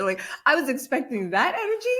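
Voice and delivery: ordinary delivery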